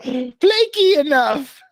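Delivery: high, slow mock French; attempted French accent. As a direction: stretch it out in a silly French accent. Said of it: "French accent".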